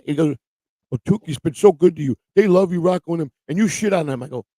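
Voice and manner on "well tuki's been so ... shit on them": mock scolding. Quotes a critic scolding him.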